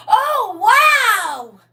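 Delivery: put-on high, loud, amazed child's "wow"; imitating a grandson thrilled by light-up shoes; excited grandson. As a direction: squeal it like a thrilled little boy. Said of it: "He said".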